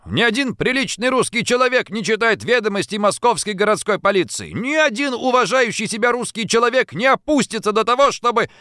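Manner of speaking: high-pitched indignant tirade, pressing on without let-up; flaring up indignantly. A narrator's reading. Schoolboy lectures the newspaper reader, flaring up indignantly. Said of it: "Гимназист вспыхнул".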